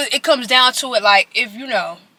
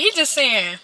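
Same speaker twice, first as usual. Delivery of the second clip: normal talking voice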